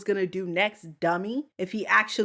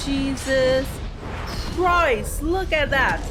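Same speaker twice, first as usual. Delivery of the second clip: amazed; 6 semitones higher, 4 dB louder, slower than usual. Real, not acted. Amazed at scene.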